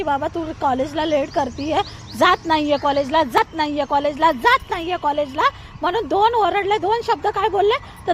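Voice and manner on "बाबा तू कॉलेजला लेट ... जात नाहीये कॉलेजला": lower, gruff mock father scolding; imitating dad. Mother imitating the father's scolding to her daughter, lower voice. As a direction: ape the father's complaint. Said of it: "पप्पा काय म्हणले तिचे की".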